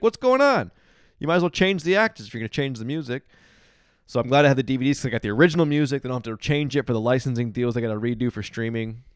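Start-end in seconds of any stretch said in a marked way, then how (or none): 0.0-3.2 exasperated, high and loud retold outburst; mock outrage. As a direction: act out a baffled viewer's loud complaint. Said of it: "I'd be like".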